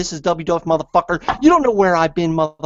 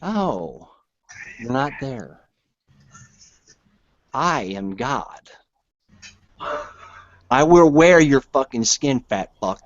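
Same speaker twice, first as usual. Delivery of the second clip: low menace; 3 semitones lower, slower than usual. Real, not acted. Menacing threat to caller.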